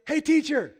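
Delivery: high, loud shout to get attention; heckling yell. Retold or imitated. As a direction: yell it from the back of a crowd. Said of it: "he yells at Jesus".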